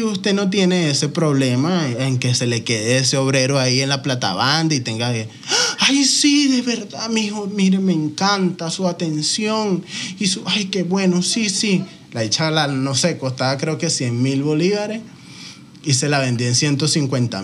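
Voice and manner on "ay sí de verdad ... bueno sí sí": put-on higher, sugary old-lady voice; gushing old lady. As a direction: play a flattered old lady cooing at the seller.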